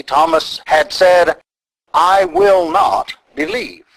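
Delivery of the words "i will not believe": high, slow, every word pressed hard; emphatic refusal. Retold or imitated. Preacher quoting Thomas's refusal, emphatic and slow. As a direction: slam the door on believing. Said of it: "his words there are emphatic".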